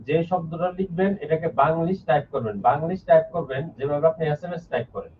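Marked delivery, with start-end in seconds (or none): none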